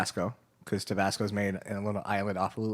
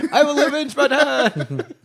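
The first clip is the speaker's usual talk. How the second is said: put-on high, slow, overblown vengeful kid; avenging son voice; 9 dB louder than usual. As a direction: squeak it out like a wronged heir. Son vows revenge, acted voice.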